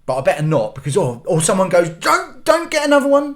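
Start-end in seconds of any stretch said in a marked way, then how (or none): 1.9-3.4 shrill-free high nagging voice; high mock scold. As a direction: nag him not to take another one. Someone scolding about Mars bar.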